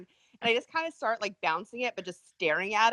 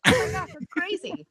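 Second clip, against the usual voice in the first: retold surprise; 9 semitones higher than usual. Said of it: "I was like".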